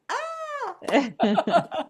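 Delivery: high, loud squeaky critter cry; a short squeal like a baby possum; tiny possum shriek, played for cute. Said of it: "my little baby".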